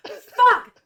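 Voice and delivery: high, loud angry cry; furious shout. Acted. GM as Marina catching the thief, shouting. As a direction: explode at the thief.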